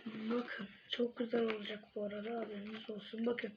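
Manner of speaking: ordinary delivery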